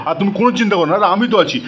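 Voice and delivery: usual speaking voice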